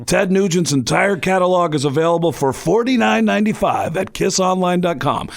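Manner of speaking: put-on rock star sales pitch. Rock star interrupting the music guests to sell, put-on impression. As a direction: cut in smoothly with a mock rock-star plug. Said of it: "doing this impression of [name]".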